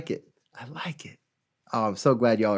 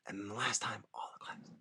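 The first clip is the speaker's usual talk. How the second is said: whispered instruction; teacher whispering the last verse rule; 3 semitones lower, 21 dB quieter, faster than usual. Real, not acted. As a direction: whisper it like a secret. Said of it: "whispered instruction".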